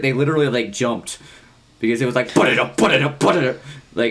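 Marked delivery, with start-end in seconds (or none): 2.3-3.6 loud mouthed drum burst, jumping high; imitated drum part. Band member mimicking the drum part that startled the audience, loud imitated drumming. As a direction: punch it out like a sudden drum fill. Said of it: "this really badass, like, drum part".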